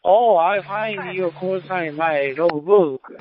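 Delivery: ordinary talk, nothing marked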